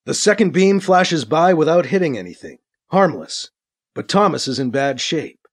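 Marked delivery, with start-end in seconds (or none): none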